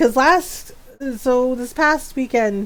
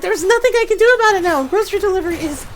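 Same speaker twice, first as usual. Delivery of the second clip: higher, louder, fast exasperated retort; mock-annoyed, pitched-up and quick; 8 semitones higher, 4 dB louder than usual. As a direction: rattle it off in frustration. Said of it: "Yes, I'm like".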